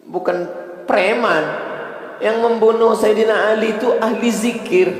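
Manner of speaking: very high, loud climactic proclamation; preaching at full force. Acted. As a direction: deliver the twist like a thunderclap.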